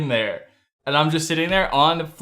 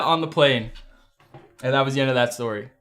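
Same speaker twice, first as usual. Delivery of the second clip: usual speaking voice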